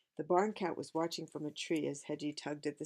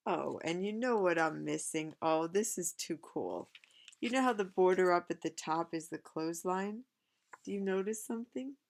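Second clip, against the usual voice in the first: excited; 3 semitones higher, 6 dB louder than usual. Real, not acted. Excited discovery to child.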